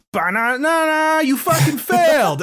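high, sing-song game-over jingle; mock failure fanfare. Retelling the game's verdict to co-hosts, mock failure fanfare. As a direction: blare it out as a high, taunting game announcement.

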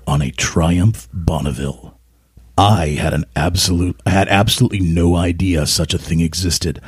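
low, measured radio-presenter read. Pretend. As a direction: drop low and read it like a broadcaster. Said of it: "I'll do it radio voice".

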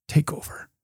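a hushed, intimate surrender; whispered plea. Shown as a demonstration. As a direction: whisper it, voiceless. Modelled fearful surrender prayer.

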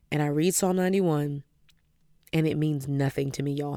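usual speaking voice

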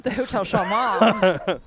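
slow laughing repeat. Real, not acted. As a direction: laugh through the name. Laughing at hotel name.

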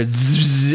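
buzzing taser noise, drawn out; imitated buzz. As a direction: make a long electric zap. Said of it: "then you hear".

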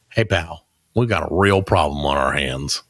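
low, measured folksy warning; low-key warning. Acted. Telling friends a favorite phrase, low warning. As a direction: keep it down, like gentle trouble brewing. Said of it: "my favorite one is to say".

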